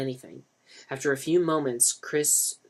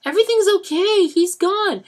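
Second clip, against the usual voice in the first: reassuring; 9 dB louder than usual. A narrator's reading. Denny tries to calm them.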